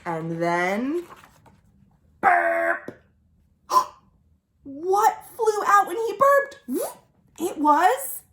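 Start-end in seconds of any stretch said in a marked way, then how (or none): 2.2-3.0 very low burp sound. Acted. Croc burps.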